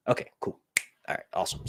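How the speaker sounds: whispered relief under the breath; relieved whisper. Real, not acted. Streamer relieved the sound works, whispering. Relieved after checking chat.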